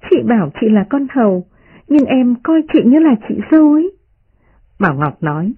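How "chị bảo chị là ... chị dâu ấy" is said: much higher, playful mocking girl; teasing young lady. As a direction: tease them with a laughing lilt. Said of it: "Đại Ngọc cười nói".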